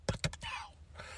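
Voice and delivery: voiceless mock-angry hush; Samoa Joe, angry. Joe scolding Hook over chips, whispered mock anger. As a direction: repeat the order in a whisper. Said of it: "He's angry".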